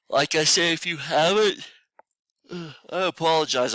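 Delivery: stifle a real morning yawn mid-sentence. Real, not acted. Tired teacher apologizing to students first thing in the morning, yawning. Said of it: "I always yawn".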